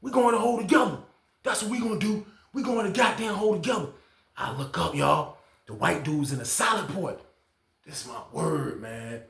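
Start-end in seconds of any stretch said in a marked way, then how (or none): 0.0-3.9 hyped insistence. Retold or imitated. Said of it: "He said".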